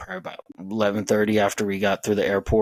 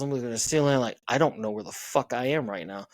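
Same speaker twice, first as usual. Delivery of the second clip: ordinary talk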